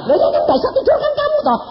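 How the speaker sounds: high, loud, cheeky needling voice; a squeaky impudent insomniac teasing; mock-cheeky high squeak; cheeky caller voice. Voicing the caller's cheeky reply.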